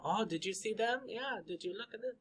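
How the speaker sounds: soft, casual chattering questions; talkative person. Acted. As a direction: chatter lightly under your breath. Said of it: "but they like to talk".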